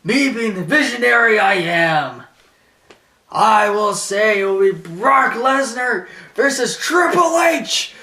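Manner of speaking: booming, drawn-out wrestling promo; Paul Heyman. Retold or imitated. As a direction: proclaim it grandly, high and loud, stretching key words. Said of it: "he's like this".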